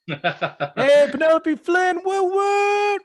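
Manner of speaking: high, loud whooping cheer; mock cheering. Guest cheering Penelope Flynn for the interviewer, high whooping shout. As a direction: whoop it out high, holding the last note.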